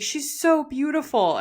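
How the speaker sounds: teary husband. Retold or imitated. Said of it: "he's, like, crying".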